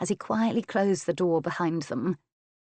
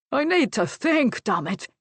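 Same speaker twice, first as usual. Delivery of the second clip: raised, loud opening, dropping on the curse; exasperated roar; 7 semitones higher, 4 dB louder than usual. A narrator's reading. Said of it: "Adam Leslie roared".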